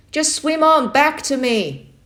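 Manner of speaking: loud slow call across water. Acted. Uncle calls to the boy to swim back, loud slow call. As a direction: call it over the river.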